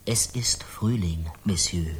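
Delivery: slow, low whisper; cold whisper. Acted. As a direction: whisper it slowly, coldly. Interrogator whispers coldly. (langsames, kaltes Flüstern)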